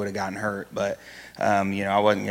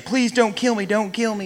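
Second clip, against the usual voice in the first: pleading intruder; 12 semitones higher than usual. Retold or imitated. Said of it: "he immediately started saying".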